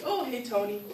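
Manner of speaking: high, casual mock greeting; all casual. Kid on stage greeting an imagined superhero, casual. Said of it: "I would be all casual".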